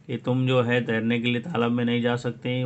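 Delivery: normal talking voice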